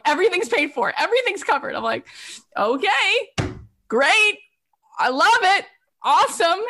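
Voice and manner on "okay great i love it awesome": high, punchy mock-eager replies; delighted acceptance. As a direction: accept each gift with bouncy, pitched-up glee. Retold delight at everything being free.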